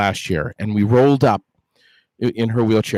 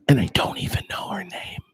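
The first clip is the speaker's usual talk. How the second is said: whisper; 6 semitones higher, 6 dB quieter than usual. Real, not acted. Whispered aside after the bit.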